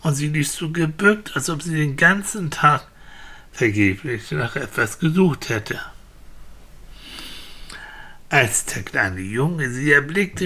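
ordinary delivery, nothing marked

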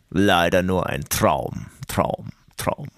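very deep, slow jingle voice with fading echo. Pretend. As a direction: drop into a booming bass for a radio jingle. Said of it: "wichtig ist einfach nur die tiefe Stimme".

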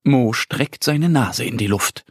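usual speaking voice

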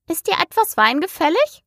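high, pushy offer of wine; pressing offer. A narrator's reading. The March Hare urges wine on Alice, high and pushy. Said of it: "nötigte sie der Faselhase".

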